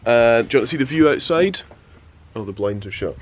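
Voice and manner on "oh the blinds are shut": ordinary talk, nothing marked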